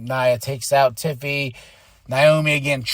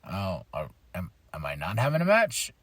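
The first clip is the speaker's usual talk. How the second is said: low, soft, halting mock confusion; hesitant, quietly lost question; bewildered wrestler; 8 semitones lower, 8 dB quieter than usual. Mimicking Aleister left standing in the ring, bewildered. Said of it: "standing there looking like an idiot, just like".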